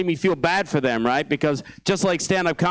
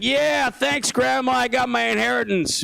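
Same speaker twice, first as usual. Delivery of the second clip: higher, gleeful greedy neighbor voice; mock gleeful neighbor; 7 semitones higher than usual. Neighbor thanking covid for the inheritance, gleeful and high. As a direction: sound delighted the grandma is gone. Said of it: "He's like".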